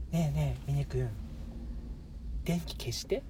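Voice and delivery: put-on romantic girlfriend voice. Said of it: "ものすごくロマンチックなんよ".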